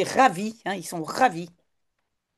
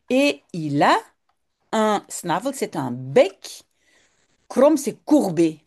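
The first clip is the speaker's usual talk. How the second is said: ordinary talk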